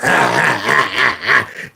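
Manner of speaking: low mocking cackle; mocking laugh. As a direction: laugh down at the teacher. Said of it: "And he had this laugh".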